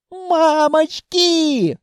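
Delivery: very high, drawn-out squeal; frightened squeal. A narrator's reading. Bystander pressed to the wall as throne races past, squealing. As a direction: squeal in panic, stretching the word. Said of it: "взвизгнул кто-то".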